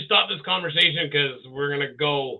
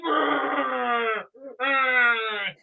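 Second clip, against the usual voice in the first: mock wail; 6 semitones higher than usual. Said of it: "And she's like".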